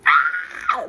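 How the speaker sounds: short high-pitched reenacted scream. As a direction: imitate a sudden shriek. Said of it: "I screamed. I was like".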